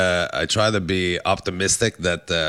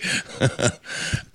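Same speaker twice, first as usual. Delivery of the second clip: wild rambling cackles; 4 semitones higher, 5 dB quieter than usual. Real, not acted. Laughing after impression.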